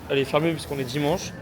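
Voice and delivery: ordinary talk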